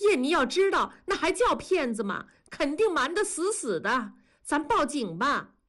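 high-pitched, agitated argument; indignant retort. A narrator's reading. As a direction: fire back at the husband, outraged. Angry wife argues with husband. (气愤地高声反驳)

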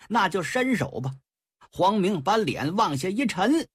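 ordinary delivery

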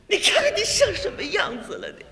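high-pitched scolding, sputtering; angry wife. Acted. Wife scolds gambling husband, angry. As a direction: act an indignant wife telling off her husband. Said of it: "爱人跟我就火了".

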